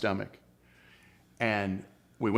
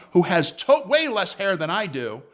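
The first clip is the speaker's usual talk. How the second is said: normal talking voice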